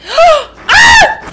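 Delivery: loud gasp then a scream; frightened gasp and shout. Real, not acted. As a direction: caught off guard, cry out. Spider jumps at the player.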